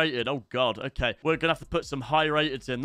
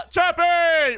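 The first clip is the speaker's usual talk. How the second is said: high, slow, louder shout-out; excited call; 10 semitones higher, 6 dB louder than usual. Real, not acted. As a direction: call his name out delighted. Greeting a favourite player.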